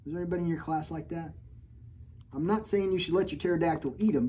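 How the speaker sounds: usual speaking voice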